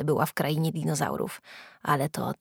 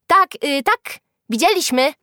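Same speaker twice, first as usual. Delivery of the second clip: high, loud confirmation; calling out agreement; 9 semitones higher, 7 dB louder than usual. A narrator's reading. Other children back the accusation, calling out. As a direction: chime in loudly. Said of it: "zawołali inni".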